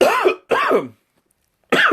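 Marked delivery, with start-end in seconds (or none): 0.0-0.0 choking on a sip, coughing; spluttering water. Real, not acted. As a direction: sputter after it goes down the wrong pipe. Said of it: "went down the wrong pipe".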